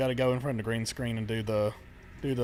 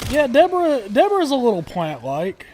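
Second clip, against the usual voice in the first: excited; 10 semitones higher, 9 dB louder than usual. Real, not acted. Excited naming the monster.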